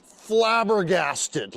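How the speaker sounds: drawn-out flabbergasted. Pretend. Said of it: "be like, just flabbergasted".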